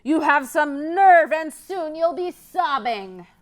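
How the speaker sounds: high, loud threatening troll; roaring troll. Acted. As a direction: menace the little goat like a grumpy troll. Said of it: "roared the troll".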